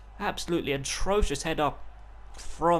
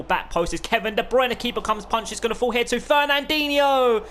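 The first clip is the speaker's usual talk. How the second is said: rising, louder as the chance builds; excited commentary; 7 semitones higher, 4 dB louder than usual. Real, not acted. Gamer calling his own attack, getting excited. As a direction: call the scramble with mounting excitement. Goalmouth scramble, keeper punches.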